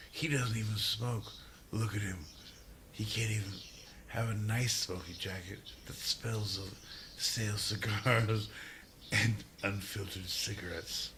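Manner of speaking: conspiratorial mock gossip; whispered aside. Real, not acted. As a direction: whisper it behind his back.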